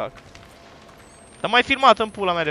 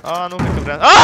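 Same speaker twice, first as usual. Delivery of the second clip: normal talking voice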